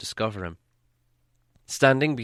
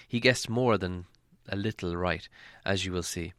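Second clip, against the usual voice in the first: ordinary delivery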